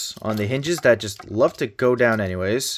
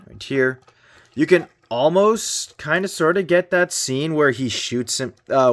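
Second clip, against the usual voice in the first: usual speaking voice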